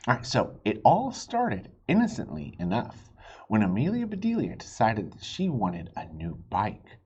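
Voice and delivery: usual speaking voice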